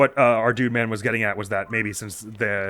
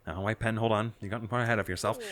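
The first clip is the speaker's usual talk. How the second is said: ordinary talk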